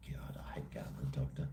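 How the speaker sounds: a soft breathy whisper under the breath; whispered aside. Real, not acted. Muttered aside about doctors.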